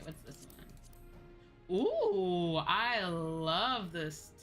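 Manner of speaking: normal talking voice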